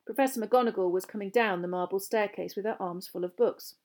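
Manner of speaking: plain reading voice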